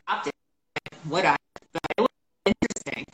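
glitchy robotic voice over a breaking connection; choppy robot voice, like a bad video call. Real, not acted. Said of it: "all we hear is you talking like a robot".

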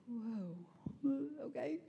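a shocked little whisper to yourself; stunned whisper. Retold or imitated. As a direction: breathe it out in a hushed whisper. Stunned by a large grocery total.

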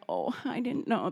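mother meekly answering the nursery staff; sheepish soft reply. Retold or imitated. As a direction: say it small and sheepish. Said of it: "I'm like".

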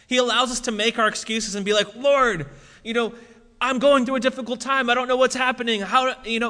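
impassioned sermon delivery; full-force preaching. Acted. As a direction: preach it out to the congregation.

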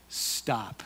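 a low, soft, firm stop. Acted. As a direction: quietly halt them in Jesus' voice. Jesus tells weary people to stop.

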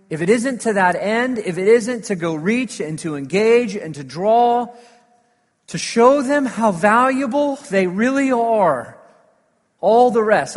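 loud, driving preacher's build; full-force preaching. Real, not acted. Pastor urges the church toward the lost, in loud driving preaching. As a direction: press the church hard on what matters.

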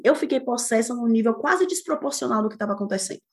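normal talking voice

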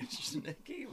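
laughing, high incredulous question; can't believe that name; amused disbelief. Real, not acted.

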